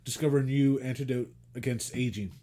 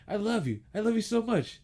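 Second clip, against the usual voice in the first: mock sweet husband; 9 semitones higher than usual. Husband sweet-talking wife in story.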